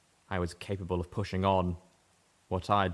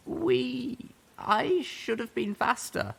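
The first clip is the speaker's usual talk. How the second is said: high, slow, apologetic; a pony voice cracking with guilt; guilty pony voice; much slower than usual. A narrator's reading. Pony heroine apologising to the young zebra, voice high and halting.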